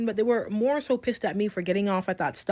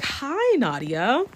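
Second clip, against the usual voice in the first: mock sweet greeting; 4 semitones higher, much slower than usual. Girl grabs her and greets her.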